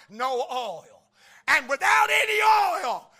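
shouted, high, rising preacher's cry; big revival shout with a preacher's pull on the words; preaching at full force. Acted. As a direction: thunder it out to the congregation.

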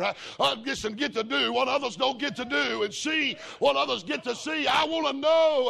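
shouted, high-pitched revival preaching; full-force preaching. Acted. Preacher exhorting the congregation, shouting high. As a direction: preach it out loud to the rafters.

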